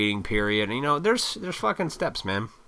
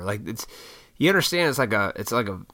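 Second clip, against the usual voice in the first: usual speaking voice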